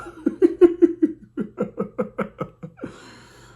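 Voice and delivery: long high-pitched laughing fit; loud laugh that trails on; derisive laughter. Real, not acted. Reader ridiculing the book, long high laugh. Laughing after mock shock.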